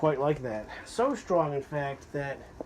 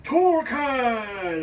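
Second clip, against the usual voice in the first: Torcan! boomed much higher and louder, drawn out and falling like a hero's name; superhero announcement; 10 semitones higher, 8 dB louder than usual. Shown as a demonstration. Vlogger hamming up the brand name as a superhero, booming. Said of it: "It sounds like a superhero".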